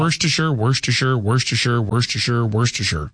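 Worcestershire repeated in a quick, even run; Worcestershire five times fast. Shown as a demonstration. Host answering a dare to say it five times, tongue-twister run. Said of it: "Say that five times fast".

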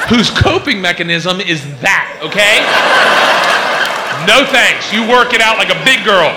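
mock stern yell. Dad scolding imagined daughter.

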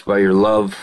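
usual speaking voice

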